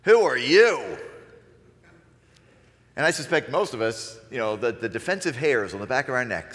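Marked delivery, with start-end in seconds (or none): 0.0-1.0 confrontational challenge. Shown as a demonstration. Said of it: "Change the inflection".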